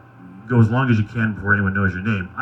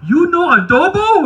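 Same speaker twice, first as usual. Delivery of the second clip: high, loud, drawn-out cook lady voice; Filipino cook lady; 7 dB louder, slower than usual. Retold or imitated. Cook lady to soldier in chow line, high excited squeal. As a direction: imitate an excited cook calling out in a high pitch. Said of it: "the nice Filipino cook lady goes".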